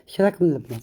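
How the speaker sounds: ordinary talk, nothing marked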